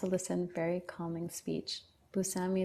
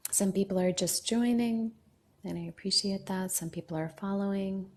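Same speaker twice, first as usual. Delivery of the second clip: normal talking voice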